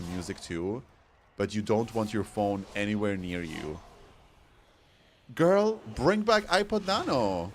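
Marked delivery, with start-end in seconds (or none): 5.3-7.5 high, slow plea; pleading. Real, not acted. Vlogger begging Apple for the Nano, high and slow. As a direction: beg for it to come back, drawn out.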